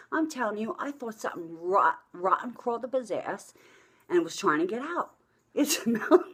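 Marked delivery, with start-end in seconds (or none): 5.5-6.2 high, loud, breaking into laughter; helpless real laughing while talking. Real, not acted. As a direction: crack up on the words. Breaking up telling fart story.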